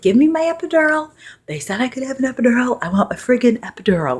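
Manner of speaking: high, loud, frantic begging; patient in pain begging for an epidural, high and loud; frantic demand. Retold or imitated. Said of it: "Like, I'm freaking out".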